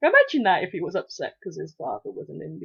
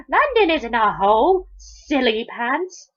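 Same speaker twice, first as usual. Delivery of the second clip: higher, louder put-down, drawn out at the end; condescending; 5 semitones higher, 6 dB louder than usual. A narrator's reading. Polly corrects the boy about London, condescending. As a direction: talk down to a silly child.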